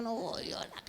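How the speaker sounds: whispered no under her breath; whispered refusal. Retold or imitated. (susurro de negativa)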